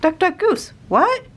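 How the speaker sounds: high, puzzled mock kids' voice; imitating confused kids. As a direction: play baffled little kids. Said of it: "They're like".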